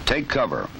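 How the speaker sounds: ordinary delivery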